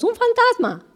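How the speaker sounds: a sailor seeing a ghost on the water; high frightened scream of ghost; terrified cry. Retold or imitated. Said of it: "No gritamos como ellos".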